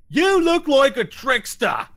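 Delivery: slow, high, loud ogre voice; big slow-witted ogre, suspicious. Acted. Ogre challenging a stranger at the window, suspicious.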